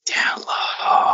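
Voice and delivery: whispered echo of the co-host; mock whisper. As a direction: whisper it back teasingly, all breath. Teasing echo of co-host's plea.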